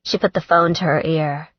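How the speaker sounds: ordinary delivery, nothing marked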